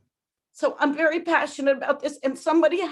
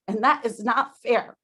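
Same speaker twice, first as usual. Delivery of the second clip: ordinary delivery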